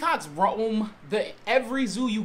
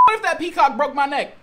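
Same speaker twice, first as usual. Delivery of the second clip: higher, louder indignant question; raised-voice retake, full of alarm; indignant outburst; 6 semitones higher, 7 dB louder than usual. Acted. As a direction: yell it in outraged disbelief. Redoes the question more forcefully.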